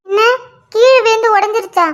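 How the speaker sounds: ordinary talk, nothing marked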